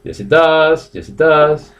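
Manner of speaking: normal talking voice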